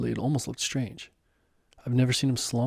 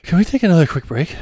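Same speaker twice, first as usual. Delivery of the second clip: breathless, louder and higher, begging for a rest; winded plea; 5 semitones higher, 8 dB louder than usual. Acted. Hiker begging his guide for a break, winded. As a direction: gasp it out, worn out from the climb.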